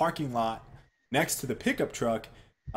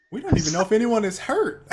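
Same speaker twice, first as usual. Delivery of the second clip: higher and louder, half-laughing disbelief; exasperated protest; 9 semitones higher, 6 dB louder than usual. Retold or imitated. Husband objecting as his girlfriend runs out, exasperated. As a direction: retell your own exasperated objection.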